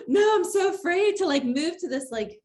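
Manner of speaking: mock panic. Host recalling her dread of an automated phone system, mock panic. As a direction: act out a dramatic, fearful protest. Acted quote of her own fearful reaction.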